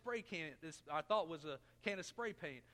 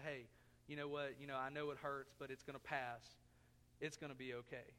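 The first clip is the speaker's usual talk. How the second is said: softer, gentle soothing words; soft fatherly comfort; 7 semitones lower, 6 dB quieter than usual. Retold or imitated. Dad comforting a child who stomped a toe, softly. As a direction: hush a crying child gently. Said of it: "pick them up and say".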